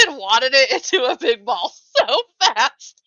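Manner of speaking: can't stop laughing at herself; laughing hard. Real, not acted. Laughing at own embarrassing story.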